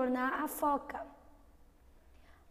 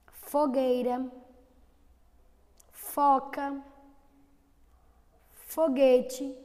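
each word louder and spaced apart; sounding out F words; 5 dB louder, much slower than usual. Shown as a demonstration. Teacher repeating the F words for the class. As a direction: say each F word slowly and clearly. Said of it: "vamos repetir apenas as figuras".

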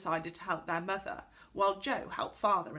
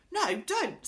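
high, loud protest; urgent objection; 11 semitones higher, 5 dB louder than usual. A narrator's reading. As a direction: stop her right there. Franny stops Connie changing.

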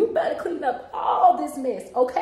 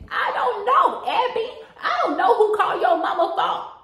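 laughing exasperated; 7 semitones higher, 7 dB louder than usual. Acted.

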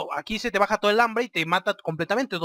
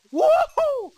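much higher whooping cheer; 5 dB louder than usual. Real, not acted. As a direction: cheer the kill. Celebrates kill.

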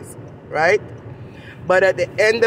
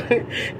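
quiet laugh; 6 dB quieter than usual. Real, not acted. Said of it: "I didn't mean to laugh".

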